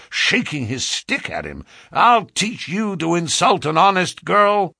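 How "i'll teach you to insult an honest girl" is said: high, loud indignant threat; hot anger. A narrator's reading. Young chap shaking his stick at a fleeing man, hot anger. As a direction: threaten him loudly, voice pitched up in outrage. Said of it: "He was so hot".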